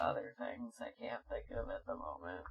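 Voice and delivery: whispering a quick afterthought; whispered aside. Real, not acted. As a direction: drop to a hushed throwaway whisper. Trailing aside after listing credits.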